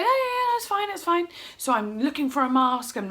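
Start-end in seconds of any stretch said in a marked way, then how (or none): 0.0-1.3 very high, quick imitation; high sing-out reassurance; reassuring caller. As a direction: mimic someone breezily agreeing, pitched high. Imitates woman on phone reassuring her.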